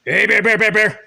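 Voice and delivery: loud calls to warn bears; scaring off a bear. Real, not acted. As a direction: shout out to scare bears away. Calling out to scare bears.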